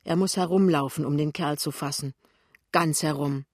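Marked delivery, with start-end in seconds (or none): none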